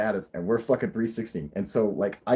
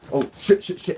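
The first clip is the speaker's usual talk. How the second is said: higher, quick panicky cursing; acted-out alarm; mock panic; 8 semitones higher, 3 dB louder than usual. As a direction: imitate a driver starting to lose control. Driver panics as car slides.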